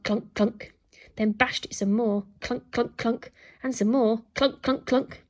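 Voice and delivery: usual speaking voice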